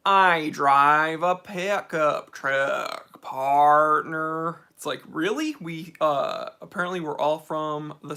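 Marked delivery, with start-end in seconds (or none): 0.1-3.2 slow, loud, hammed-up Southern American drawl; mock Southern American accent. UK friends asked to do an American: loud slow mock Southern American accent. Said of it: "do an American accent". 3.2-4.6 loud, hammed-up Southern American "partner"; mock Southern American accent. UK friends asked for an American accent: hammed-up Southern American "partner". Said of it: "do an American accent".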